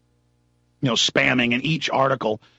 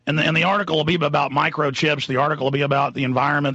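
ordinary talk, nothing marked

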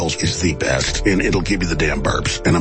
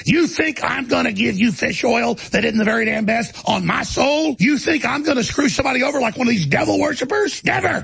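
building rant; 11 semitones higher than usual. Real, not acted. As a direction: pitch climbing to a shrill peak. Ranting, voice climbing to a peak.

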